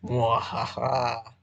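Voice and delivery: drawn-out villain cackle; slow evil laugh. Acted. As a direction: laugh slowly like a scheming villain. Villainous plan to be lazy.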